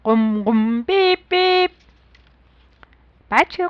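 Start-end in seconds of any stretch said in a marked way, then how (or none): 0.0-1.7 playful beep-beep of a toy car; car horn noises. A narrator's reading. Storyteller tooting a car horn for kids. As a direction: honk it like a little car.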